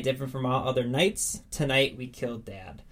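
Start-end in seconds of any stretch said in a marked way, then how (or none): none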